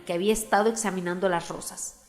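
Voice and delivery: ordinary delivery, nothing marked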